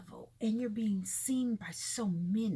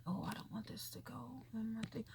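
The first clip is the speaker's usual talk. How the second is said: much softer, under the breath; muttered aside; 10 dB quieter than usual. Real, not acted. Vlogger to self about a glitch, muttered aside. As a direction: mutter it to yourself, away from the listener.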